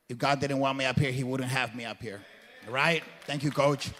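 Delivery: ordinary talk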